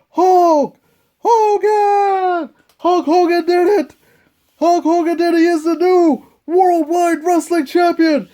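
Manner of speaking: high, thrilled title call; excited announcer. Acted. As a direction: call the new champion with joy. New champion announced.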